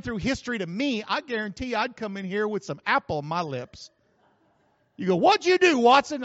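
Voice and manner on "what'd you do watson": mock accusation. Someone accusing him.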